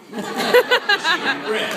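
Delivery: high, slow drawn-out reply; smug answer. Retold or imitated. Angler answering his buddies, smug and slow. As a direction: stretch it out with a smug grin. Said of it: "And you go".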